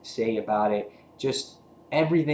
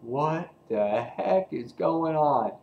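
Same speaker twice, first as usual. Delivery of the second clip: high put-on confusion, slowed down; slow bewildered voice; 4 semitones higher, slower than usual. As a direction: drag it out, totally baffled. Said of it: "are just like".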